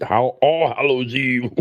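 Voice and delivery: slow, careful correction of All Hallows Eve; slowly fix "Hall-, All Hallows Eve" into the right name; self-correction. Shown as a demonstration. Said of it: "I was born on All Hallow Eve".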